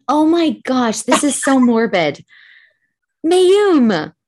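loud, high, scandalized exclamation; shocked amusement. Real, not acted. Host seeing friend's mom playing dead, scandalized. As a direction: cry out in amused horror. Reacts to the morbid photo.

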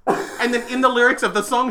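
ordinary talk, nothing marked

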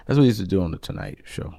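ordinary delivery

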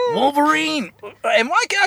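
high cartoon cry; Morph. Host mimicking Morph for a laugh, high cartoon voice. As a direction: call out the name in a high put-on voice. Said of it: "he talks like this".

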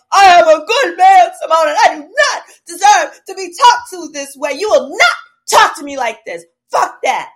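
high, loud crying protest; mad ex impression. Her ex yelling down the phone at her, high and loud. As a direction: whine it as a hurt, angry man. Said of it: "he said".

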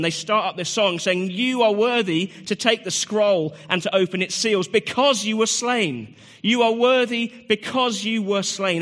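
high, loud, building preacher's cry; preaching at full force. Acted.

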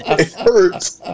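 laughing through real pain; pained laugh on the words. Real, not acted. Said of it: "Tim's trying not to laugh because he's, like, in too much pain".

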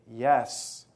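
low-pitched mumbled admission; a questioned kid admitting it, low; teen's reluctant answer. Acted. Playing a teenager answering counselor's questions.

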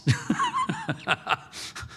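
laughing at his own joke; real chuckling laugh; amused laugh. Real, not acted. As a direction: let out a run of real laughter.

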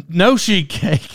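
ordinary delivery

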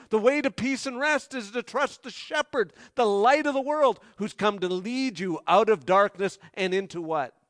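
loud, urgent preaching to the congregation; full-force preaching. Acted. Preacher pleading loudly with the congregation, full-force preaching.

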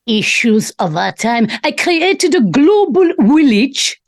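mocking German-accented impression; slow, heavy German accent, mocking the speaker; Klaus Schwab. Mimics the clip just played.

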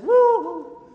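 high, loud celebratory woo-hoo; exuberant whoop. Retold or imitated. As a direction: let the whoop burst out. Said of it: "and I go".

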